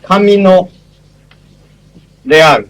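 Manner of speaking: Camino Real, said the Spanish way with a rolled r; Spanish pronunciation. Shown as a demonstration. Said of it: "many of you know Spanish".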